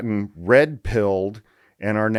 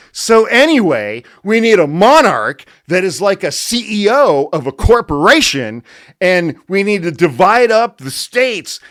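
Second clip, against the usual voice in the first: loud, high smug lecturing voice; hammed-up know-it-all monologuing at you; pompous Yarvin rant; 8 semitones higher, 6 dB louder than usual. Impersonating Yarvin at party.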